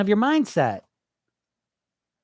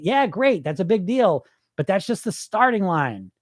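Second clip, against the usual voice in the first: ordinary talk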